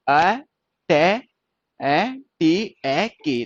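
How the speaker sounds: अ, टे, ए, टी, ए, के as separate sounds. Shown as a demonstration. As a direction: spell out अटैक one sound at a time. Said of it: "फिर आ रही के की".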